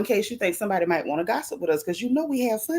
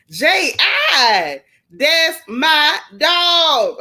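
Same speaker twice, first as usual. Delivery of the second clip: loud, high, long-drawn words; big stretched-out cheer; hollered shout-out; 6 semitones higher, 8 dB louder, much slower than usual. Real, not acted. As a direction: holler proudly for a favorite viewer.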